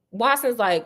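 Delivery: usual speaking voice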